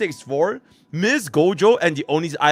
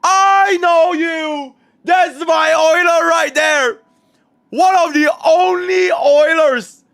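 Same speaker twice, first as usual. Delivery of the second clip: much higher, louder, slow drawn-out yells; joyful shouting; 12 semitones higher, 6 dB louder, slower than usual. Real, not acted. Recognizes loyal fan, overjoyed.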